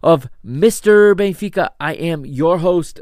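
high, loud announcer welcome; podcast host intro. Acted.

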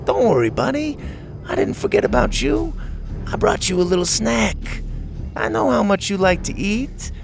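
high, cooing fake kindness; fake-sweet coaxing. A narrator's reading. Ash baits Buck with a snack, falsely sweet. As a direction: coax like talking to a pet. Bully baits leashed dinosaur.

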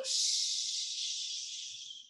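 soft, long drawn-out hushing sound; long shush. Acted. Poet plays the devil shushing mama, long shush. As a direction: hold a hushing hiss until it fades.

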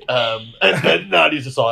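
usual speaking voice

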